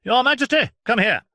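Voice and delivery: very high, loud summons; doctor calling the king. Acted. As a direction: call the king sharply. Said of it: "he cried out".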